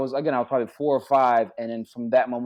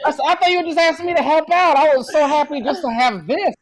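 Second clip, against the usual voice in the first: replaying his shock, much higher and louder with laughter; high, loud, laughing retold astonishment; stunned disbelief; 9 dB louder than usual. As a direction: relive the moment you learned you got the part, laughing in disbelief. Retold shock at getting offered part.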